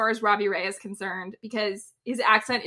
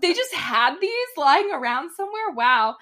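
higher-pitched incredulous reaction; retold astonishment, pitched up; amazed; 6 semitones higher, 3 dB louder than usual. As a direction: marvel at it in disbelief. Retelling own amazed reaction.

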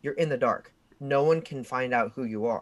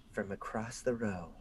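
ordinary talk, nothing marked

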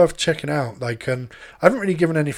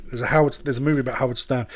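usual speaking voice

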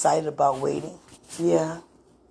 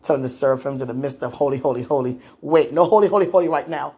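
usual speaking voice

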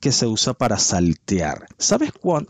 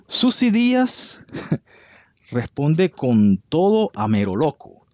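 Susy Díaz launched much higher and slowly, like a gossip-show anchor; dramatic headline read; 5 semitones higher, slower than usual. Retold or imitated. Host reading a gossip headline to viewers, hammed-up announcer. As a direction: belt the headline like a tabloid host. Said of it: "Y dice así".

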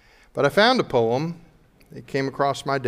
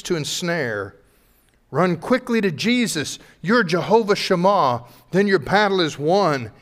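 rising, fervent poem reading building louder; poem recitation; 4 semitones higher, 5 dB louder than usual. Acted. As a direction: recite the poem with mounting passion. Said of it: "Let me read it to you".

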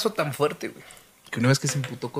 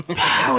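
impact sound effect; 9 semitones higher, 4 dB louder than usual. Retold or imitated. Sound of cap hitting face.

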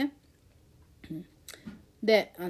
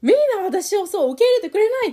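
much higher, louder mock lament; 被害妄想の嘆き; 13 semitones higher, 10 dB louder than usual. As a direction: act out feeling rejected by everyone, pitched high and loud. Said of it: "なんか被害妄想になるんだけど". (被害妄想っぽく大げさに嘆く声)

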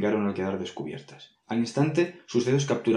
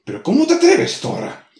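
outraged demon; 4 semitones higher, 6 dB louder than usual. Acted. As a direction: pitch shoots high in outrage.

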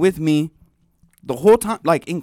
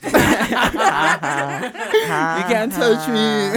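slow taunting; 4 semitones higher, slower than usual. Retold or imitated. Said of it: "Pablo was laughing behind the fence".

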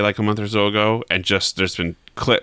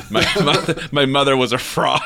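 higher, breaking into laughter; laughing through the line; 7 semitones higher than usual. Real, not acted. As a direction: try to say it through giggles. Joke teller cracks up mid-line.

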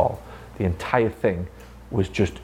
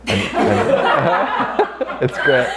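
laughing through the words; real amused laughter, slow; 6 semitones higher, 4 dB louder, much slower than usual. Real, not acted. Boss laughing while telling a pay story, laughing.

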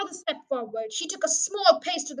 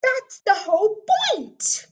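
high, loud, exasperated cry; cried out; 7 semitones higher, 6 dB louder, slower than usual. A narrator's reading. Mister Hazel answers the sergeant about the shoot, crying out. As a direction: burst out, drawing the words out. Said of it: "cried Mister Hazel".

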